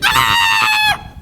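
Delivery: very high shrill scream. Acted. Farmer's wife shrieking as the snake bites. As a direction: shriek in sudden pain. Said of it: "And she screamed".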